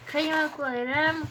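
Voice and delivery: usual speaking voice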